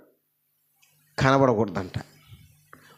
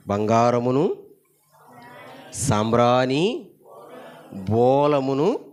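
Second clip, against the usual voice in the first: slow listing; much slower than usual. Acted. Lists gifts slowly.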